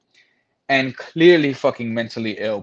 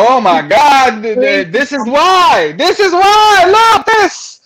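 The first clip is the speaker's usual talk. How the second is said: exasperated outburst; 12 semitones higher, 8 dB louder, slower than usual. Retold or imitated. Retelling exasperated realization.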